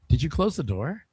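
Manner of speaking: ordinary delivery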